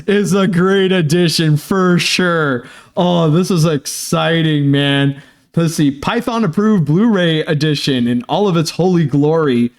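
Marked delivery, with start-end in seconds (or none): none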